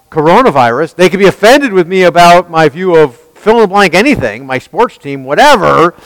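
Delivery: fiery sermon delivery; preaching. Acted. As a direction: preach it hard to the room. Preaching at full force.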